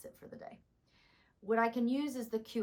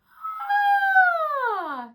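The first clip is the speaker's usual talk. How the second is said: sudden high loud "Ah!" with a late, rough onset; cracked delayed ah; 15 dB louder than usual. Shown as a demonstration. Voice teacher demonstrating tired cords to students, cracked ah. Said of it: "So I can show you what that sounds like".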